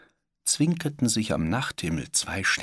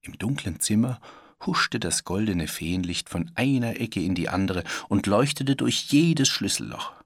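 usual speaking voice

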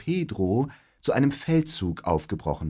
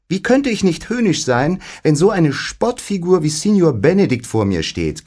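high, loud snippy retort; 7 semitones higher, 6 dB louder than usual. A narrator's reading. Beatrice fires back at Benedikt, high and snippy. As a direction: snap it back at him. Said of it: "gab Beatrice schnippisch zurück".

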